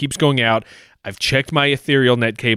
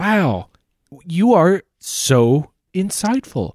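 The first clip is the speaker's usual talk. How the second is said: slow, higher dripping sarcasm; sarcastic; 7 semitones higher, 4 dB louder, much slower than usual. Acted. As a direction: drag out the fake praise. Caller mocks agent's obvious guess.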